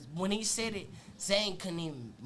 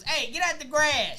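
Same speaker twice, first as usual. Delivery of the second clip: higher, louder call across the yard; 7 semitones higher, 6 dB louder than usual. Retold or imitated. Someone in the park calling to the kids, loud. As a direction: yell it across the grass. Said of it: "the only thing I heard out there was".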